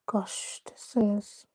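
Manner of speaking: sighed, unvoiced aside to herself; frustrated whisper. Real, not acted. Host whispering to herself as the connection fails, frustrated whisper. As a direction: mutter it under your breath, fed up. Mic trouble, sighs to herself.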